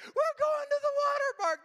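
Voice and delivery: put-on high, squealing excited kid voice; imitating giddy children. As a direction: gush like a thrilled child. Kids excited about water park.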